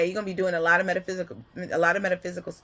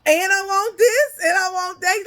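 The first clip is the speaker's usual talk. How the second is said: put-on higher and louder, a kid listing wants; a little kid asking for everything; whiny child; 10 semitones higher, 5 dB louder than usual. Child glancing back at dad, higher and louder. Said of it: "You looking back like".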